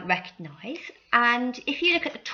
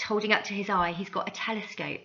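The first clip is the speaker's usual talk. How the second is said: ordinary talk, nothing marked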